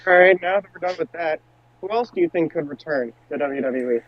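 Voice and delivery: ordinary delivery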